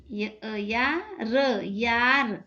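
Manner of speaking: अ, या र said very slowly, then यार; slow blending of sounds. Shown as a demonstration. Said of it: "ई के दो नुक्ते देंगे". (अक्षर धीरे-धीरे जोड़कर पढ़ना)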